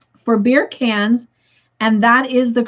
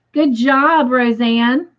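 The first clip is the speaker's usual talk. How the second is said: ordinary delivery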